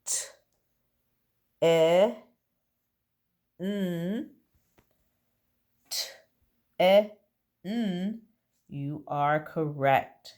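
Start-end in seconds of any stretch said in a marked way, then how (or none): 0.1-4.3 each letter sound said separately, with pauses between. Shown as a demonstration. Teacher to pupils, sounding out a word letter by letter. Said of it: "if you're saying". 5.9-8.2 each letter sound said separately, with pauses between. Shown as a demonstration. Teacher to pupils, sounding out a word letter by letter. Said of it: "if you're saying".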